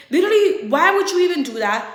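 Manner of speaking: mildly acted exasperated demand; retold telling-off. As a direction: tell off a boyfriend.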